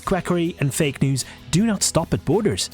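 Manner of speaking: ordinary delivery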